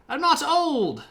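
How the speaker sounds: very high, loud protest; indignant peasant. Retold or imitated. Dennis protesting to the king, very high and loud. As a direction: bristle at being called old. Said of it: "Dennis retorting".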